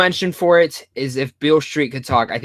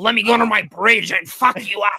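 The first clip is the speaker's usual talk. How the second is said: higher cartoon troll taunt; 6 semitones higher than usual. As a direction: growl it like a bridge troll. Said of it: "I do my troll voice".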